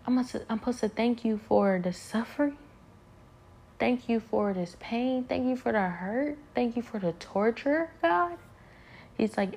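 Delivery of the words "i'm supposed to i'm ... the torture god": higher-pitched disbelief, each phrase rising; incredulous questioning. Acted. Doubter asking God about giving thanks for suffering, incredulous. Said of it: "you think it's crazy 'cause you're like".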